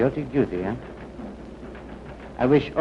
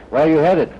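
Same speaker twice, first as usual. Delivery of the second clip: loud; 4 semitones higher, 6 dB louder than usual. Acted. Guard interrogating traveler.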